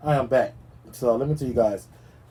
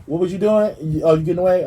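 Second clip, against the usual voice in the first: mock taunt; 6 semitones higher, 4 dB louder than usual. Mocking fleeing suspect.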